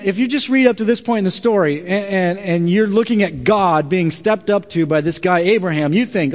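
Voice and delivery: ordinary talk, nothing marked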